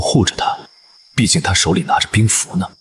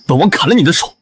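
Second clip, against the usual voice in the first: higher-pitched, forceful warning; angry threat; 9 semitones higher, 4 dB louder than usual. Acted. Prince forbids servant touching his companion, angry threat. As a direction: warn him off fiercely.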